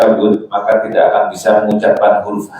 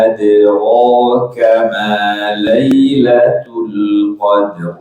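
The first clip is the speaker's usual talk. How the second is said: Quran recitation. Shown as a demonstration. Teacher recites Quran verse.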